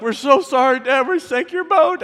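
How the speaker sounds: put-on high, sheepish kid voice; mock guilty kids. Sons apologizing to their dad, high sheepish kid voice. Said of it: "Eli and Kyle's like".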